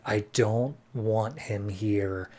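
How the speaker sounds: slow, soft mock grumble; slow, hushed mock complaint; Hex's grumbling aside. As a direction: drag it out like you're sick of him. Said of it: "Hex is like".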